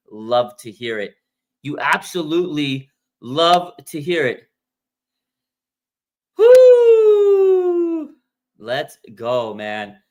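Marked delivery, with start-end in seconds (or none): 6.4-8.1 loud high whoop; hype yell. Real, not acted. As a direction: let out a huge victory whoop.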